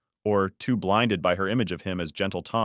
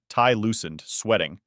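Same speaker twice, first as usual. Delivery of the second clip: plain reading voice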